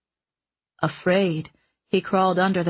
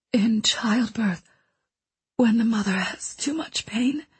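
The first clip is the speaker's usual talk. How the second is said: weak dying whisper. Acted. Dying mentor confesses her past to the boy, weak whisper. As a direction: let each phrase cost her breath.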